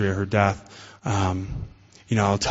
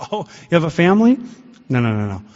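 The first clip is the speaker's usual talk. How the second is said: high, loud and quick, a feigned gasp of surprise; mock surprise; 6 semitones higher, 4 dB louder, faster than usual. God feigning astonishment that the drunk has a family. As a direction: throw it up high in fake astonishment.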